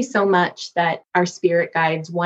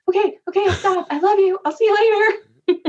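much higher, giddy okay-okay; happy agreeing; 14 semitones higher than usual. Retold or imitated. Daughter cheerfully promising her dad to stop. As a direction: agree brightly, pitched way up. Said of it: "I was like".

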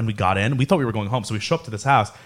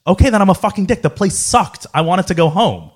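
higher-pitched, mildly heated comeback; exasperated retort; 7 semitones higher, 4 dB louder than usual. Retold or imitated. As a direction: fire back indignantly in a retold argument. Acted retort in retold quarrel.

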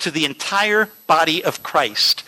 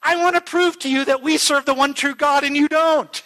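excited; 8 semitones higher than usual. Retold or imitated. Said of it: "the guy got really excited".

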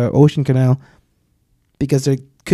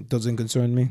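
put-on Russian accent; 3 semitones lower, 7 dB quieter than usual. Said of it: "Especially, like, in a Russian accent".

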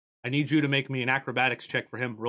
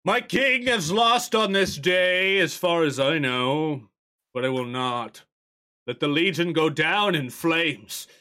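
put-on slow, loud, stirring old warrior; rally the Legion, slow and proud; much louder, slow heroic battle speech; old knight's rallying speech; 4 semitones higher, 5 dB louder, slower than usual. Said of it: "And he's like".

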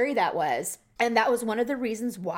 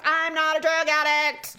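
mock indignant protest; 5 semitones higher, slower than usual. Acting out pleading for painkillers.

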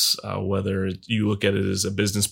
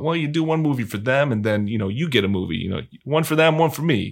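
higher-pitched, lightly put-on New York accent; Steven Spielberg; 5 semitones higher, faster than usual. Director explaining the trade of one movie for them, New York accent. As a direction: give the quote a New York lilt. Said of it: "I give him a New York accent".

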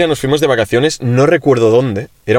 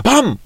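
sudden high "pam!"; playful imitated shot; mouth-made gunshot; 12 semitones higher than usual. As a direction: fake a rifle bang with the voice. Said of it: "hago con la boca".

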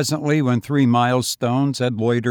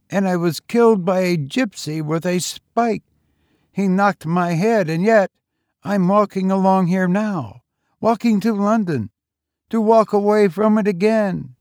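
higher, youthful lad's voice; street boy; 7 semitones higher, 3 dB louder than usual. A narrator's reading. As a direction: play the lonely young tramp, higher. Boy's voice.

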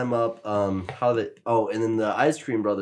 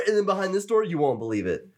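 ordinary talk, nothing marked

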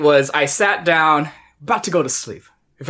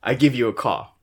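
normal talking voice

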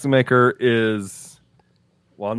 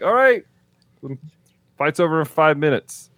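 mock dismissive; 5 semitones higher than usual. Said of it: "And it's like".

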